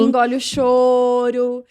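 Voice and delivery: drawn-out parental command, mock stern; stretched-out stock scolding, imitated; imitating stern parents. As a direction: recite it slowly like an old household rule. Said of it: "a gente cresceu ouvindo".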